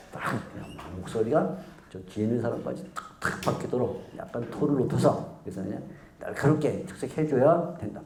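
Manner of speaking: usual speaking voice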